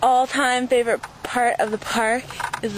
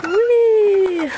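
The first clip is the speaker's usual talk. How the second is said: loud, high thrill on the swing; gleeful whee; 7 semitones higher, 5 dB louder than usual. Real, not acted. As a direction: shout with joy as you swing.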